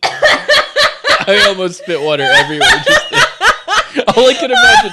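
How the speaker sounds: high, loud, laughing through words; telling it while cracking up. Real, not acted.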